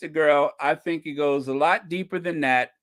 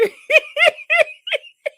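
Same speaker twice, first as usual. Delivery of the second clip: high shrieking laugh; 8 dB louder than usual. Real, not acted. Cracking up at the voice.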